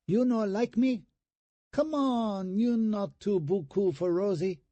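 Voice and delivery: put-on high falsetto, Vietnamese-accented come-on; falsetto hooker voice. A narrator's reading. Brother teasing brother with a dummy arm, falsetto hooker voice. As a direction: tease him in a squeaky hooker voice. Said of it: "still in his hooker voice".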